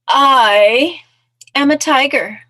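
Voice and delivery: loud, high, drawn-out proclamation; proud little storybook mouse, belting it out; boastful mouse. Acted. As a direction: announce it grandly as a tiny mouse.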